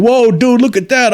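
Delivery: high, excited surfer-dude pilot; impressed pilot. Retold or imitated. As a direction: imitate a pilot gasping at the screen. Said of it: "you hear these pilots going".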